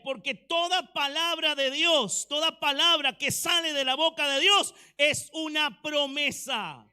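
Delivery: loud, high preaching shout; fiery sermon climax; preaching at full force. Real, not acted. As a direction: preach it out, rising to a roar.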